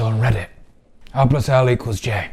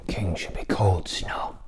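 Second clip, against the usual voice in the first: soft whispered aside; a sly sneer under the breath; 5 semitones lower, 8 dB quieter than usual. Acted. Whispered aside mocking the king.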